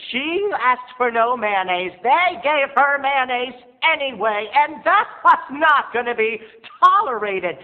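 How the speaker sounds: high, loud, slow indignation; outraged. Acted. Telling how mom got wrong sandwich, outraged high slow. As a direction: swell up with diva outrage.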